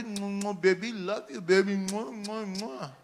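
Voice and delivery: put-on soft cooing baby talk with kisses. Preacher mocking an immature church, soft cooing baby talk. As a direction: coo it softly like to an infant. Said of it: "you know what babes' language is".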